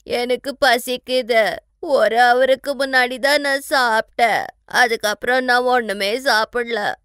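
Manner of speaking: loud tearful wail, ending in sobs; crying. Acted. New bride tells husband she is hungry, crying. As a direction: bawl like a hungry child, stretching the words. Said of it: "Shefali ரொம்ப அழுகுறா".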